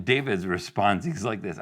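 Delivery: ordinary delivery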